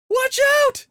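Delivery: very high, loud cry; terrified wail. A narrator's reading. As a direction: wail the warning. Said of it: "Cindy wailed".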